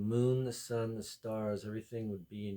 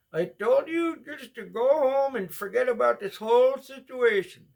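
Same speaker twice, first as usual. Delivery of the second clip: much higher, louder put-on voice; hammed-up squeaky impression; shrill mock voice; 12 dB louder than usual. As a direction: screech it like a cartoon character. Impersonation in store quarrel.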